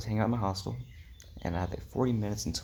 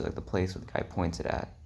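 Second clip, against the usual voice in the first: ordinary delivery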